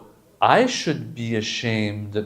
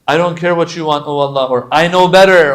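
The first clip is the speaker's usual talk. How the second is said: defiant arrogance; 5 semitones higher, 10 dB louder than usual. Retold or imitated. Mocking arrogant defiance toward Allah.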